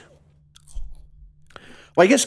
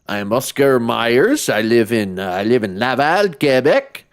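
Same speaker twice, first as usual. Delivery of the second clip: put-on slow, halting Quebecois French accent; 3 semitones lower, slower than usual. Villain's long-lost brother introducing himself, Quebecois accent. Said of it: "why he sounds Quebecois".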